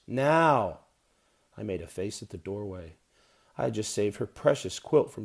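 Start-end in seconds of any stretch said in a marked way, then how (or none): 0.0-0.8 impatient call. Acted. Mother calling upstairs.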